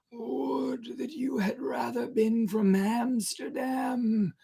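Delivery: high, slow, crying whisper of longing; strong feeling. Acted. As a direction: weep out the wish for home. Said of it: "as if with strong feeling".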